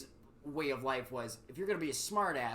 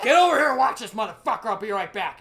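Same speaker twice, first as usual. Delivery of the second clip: shouted fast, much louder and higher; furious drill sergeant; 7 semitones higher, 14 dB louder than usual. Retold or imitated. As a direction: bark the order like an enraged sergeant. Angry drill sergeant calling out.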